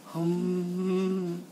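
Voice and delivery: steady humming on one note; bee hum. Acted. Honeybee recalling its young days, humming.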